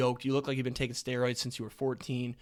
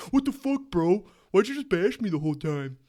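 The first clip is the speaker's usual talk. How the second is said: much higher, louder put-on indignant voice; mock outraged friend; 6 semitones higher, 6 dB louder than usual. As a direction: play the bashed friend, offended and loud. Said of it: "I can't wait for the text".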